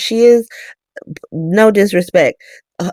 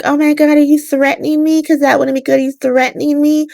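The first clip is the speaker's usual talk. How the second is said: high-pitched imitation of an indignant woman; put-on high voice of someone taken aback; mock affronted; 10 semitones higher than usual. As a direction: retell her alarmed question, pitched way up. Retold DA reacts to threat.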